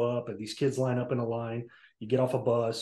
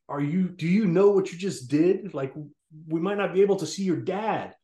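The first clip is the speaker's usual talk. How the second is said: higher, louder retold scolding; livid mother; 5 semitones higher, 3 dB louder than usual. Mom to her son after the soldiers leave, livid. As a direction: imitate an incensed mom telling off her child. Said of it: "she just was livid".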